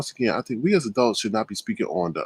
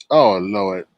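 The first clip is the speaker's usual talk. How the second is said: ordinary talk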